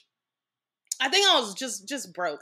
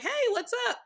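bright, much higher "hey, what's up"; cheerful greeting; 14 semitones higher, faster than usual. Retold or imitated. Guest greeting her family on the bench, unaware, high and cheerful. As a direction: sing it out as a carefree hello.

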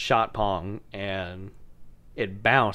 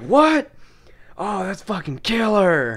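put-on high, loud drunk cheer; hammed-up delighted yell, slowed; mock excited shout; 8 semitones higher, 5 dB louder, slower than usual. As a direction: act out the dazed drunk thrill. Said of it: "I was like".

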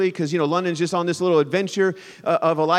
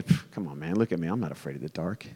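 much lower, softer scoffing brush-off; dismissive scoff; 14 semitones lower, 11 dB quieter than usual. Retold or imitated. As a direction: scoff like a tough guy. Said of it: "some of us are thinking".

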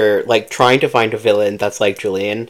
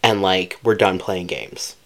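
low dominant villain; 5 semitones lower, 7 dB quieter than usual. Retold or imitated. A friend voices the villain claiming her, low and dominant. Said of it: "He is clearly like".

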